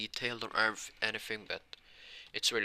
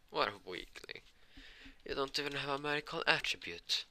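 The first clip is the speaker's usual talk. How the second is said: higher-pitched jeer at a weakling; mocking bully, pitched up; bullying taunt; 7 semitones higher than usual. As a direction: taunt him in a raised pitch. Bullies taunting Asta in story.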